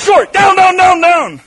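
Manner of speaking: loud, urgent shouted warning; sergeant yelling. Retold or imitated. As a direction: yell it so the whole range drops. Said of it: "what they yell is".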